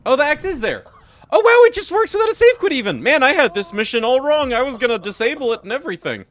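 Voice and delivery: excited. Real, not acted. Game fix suddenly works.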